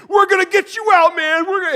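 high, loud frantic shout; urgently yelling to a trapped friend; hammed-up panicked kid rescuer; Andrew. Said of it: "my friend Andrew's like".

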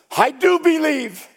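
high, sudden cry of faith. Retold or imitated. Father instantly answering Jesus, crying out. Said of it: "cried out".

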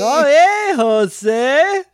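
high, loud goofy cartoon doctor voice; Dr. Nick impression. Cohost riffing on the line as Dr. Nick, goofy and high. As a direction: chirp it like a cartoon quack doctor. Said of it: "that was a little bit of ... the doctor from The Simpsons".

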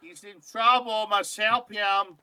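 high, slow, loud; hammed-up dim character. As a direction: spell it out slowly like a dope. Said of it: "They do a whole bit".

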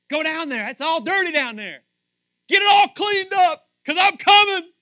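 high, loud shouted order; God's shouted command. Acted. God sending his Son down to clean up, shouting.

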